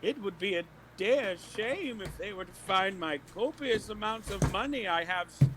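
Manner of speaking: put-on high, slow old woman voice; frail shaky old whisper. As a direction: play the helpless granny. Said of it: "look like an old woman".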